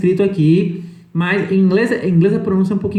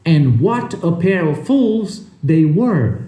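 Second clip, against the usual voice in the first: slow full sentence; slower than usual. Shown as a demonstration. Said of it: "a frase inteira".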